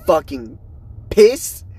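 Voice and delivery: mock angry outburst; pissed. Real, not acted. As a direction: blurt it out high and loud. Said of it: "I'm not pissed off".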